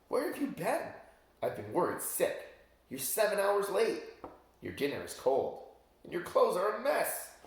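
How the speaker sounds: high, exasperated wife scolding; worried, fussing princess. Acted. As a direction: nag him for coming home late.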